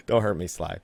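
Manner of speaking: normal talking voice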